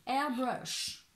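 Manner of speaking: "Airbrush" in exaggerated would-be English; superb English accent. Shown as a demonstration. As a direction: show off a mock-proud English accent on "Airbrush". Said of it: "Avec mon superbe accent anglais".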